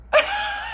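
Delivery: a sudden high giggle; delighted high laugh; high burst of laughter. Real, not acted. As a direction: laugh out at the surprise. Laugh after God's answer.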